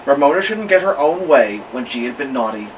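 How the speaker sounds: ordinary delivery, nothing marked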